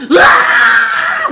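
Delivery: long, high, loud imitated crying wail; imitated child wail. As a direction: wail like a toddler left behind. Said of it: "막 이러더라고요". (아이처럼 자지러지게 우는 흉내)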